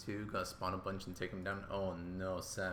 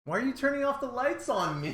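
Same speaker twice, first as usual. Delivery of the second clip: high, loud indignant yell; screaming complaint; 8 dB louder, faster than usual. Real, not acted. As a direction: yell it in shrill protest at being left in the dark. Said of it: "you see how he screams at me".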